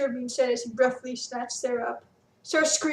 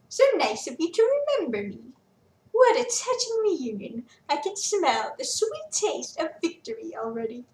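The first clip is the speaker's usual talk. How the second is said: higher, louder, sneering villain; gloating villain; 5 semitones higher, 4 dB louder than usual. Acted. Villain Quintus gloating at captured Felix, sneering. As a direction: savor the win over him.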